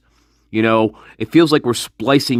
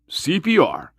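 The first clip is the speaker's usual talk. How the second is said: usual speaking voice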